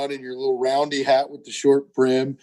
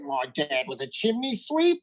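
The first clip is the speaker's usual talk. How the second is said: softer mock British accent; 7 dB quieter than usual. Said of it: "It's like the British accent".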